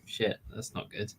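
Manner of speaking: whispered, half under the breath; hushed oh shit. Retold or imitated. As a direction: mutter it as if caught out. Retold panic on seeing landing.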